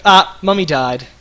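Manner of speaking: put-on loud, high, slow child's announcement; hammed-up kid voice, drawn out and loud; imitated young son. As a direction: play a kid calling out the news. Imitates son reacting to mother's sigh.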